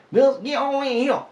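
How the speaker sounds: loud, high hillbilly holler; hillbilly accent. Pretend. Calling the hound dog in, hammed-up hillbilly holler. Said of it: "You talk like a hillbilly".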